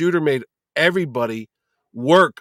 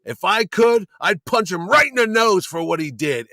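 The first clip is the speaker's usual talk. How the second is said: angry threat; 5 semitones higher than usual. Retold or imitated. Denny wants to punch Neil.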